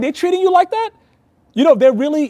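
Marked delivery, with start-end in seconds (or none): 0.0-0.9 wife's surprise. Retold or imitated. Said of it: "And she's like".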